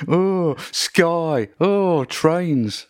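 high whiny mock moaning; playing the victim. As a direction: whine it like a sulky moaner. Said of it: "of self-pitying".

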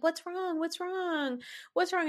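high, sing-song fake concern; mock concern. Mocking the housemates who ask the crying woman, high fake concern. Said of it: "they're like".

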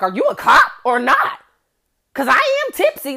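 loud high panicked yell; alarmed yell. Retold or imitated. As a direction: shout it, rattled. Scared on highway yelling.

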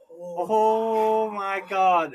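long drawn-out, loud exclamation; retold shock. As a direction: stretch it out high and loud in mock horror. Retelling his shocked reaction to story.

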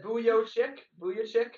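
Vujovic with a long O in the middle, ending "chick"; Clyde's mispronunciation. Retold or imitated. Quoting the broadcaster's slip, long-O Vujovic. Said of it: "He said Vujovic".

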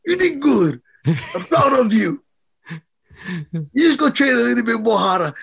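a tough father softening into praise; proud dad. Retold or imitated. Boxer imitating his dad praising him after a loss, gruffly proud. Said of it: "he was like".

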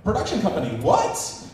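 a high, indignant squeak of disbelief; mock outraged demand. Network boss to production company, high mock outrage. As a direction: shrilly demand what happened. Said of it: "Then Animal Planet goes".

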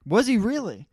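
surprised. Real, not acted. Surprised by Pennywise news.

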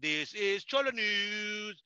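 slow, drawn-out mock newscaster; mock weather report. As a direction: announce the weather like a TV anchor. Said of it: "I'll be like".

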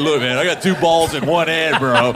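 lightly voiced bro showing off; bragging dude. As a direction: boast like a dude to his buddy. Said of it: "hanging out with a dude who goes".

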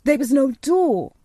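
put-on incredulous boy raising his voice; crying out in disbelief. A narrator's reading. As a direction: insist on it, amazed. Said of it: "cried Colin".

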